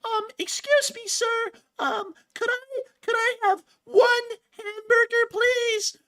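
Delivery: put-on very high, slow, childish falsetto; timid kid voice. Pretending to hide from fans, squeaky timid child. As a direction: whimper it like a shy little kid asking. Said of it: "I may go around and go".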